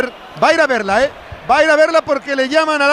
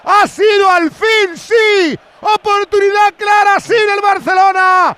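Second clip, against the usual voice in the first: excited commentary; 5 semitones higher than usual. Real, not acted. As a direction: shout the play as it happens. Commentator calls the goal live.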